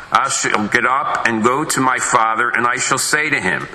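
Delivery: usual speaking voice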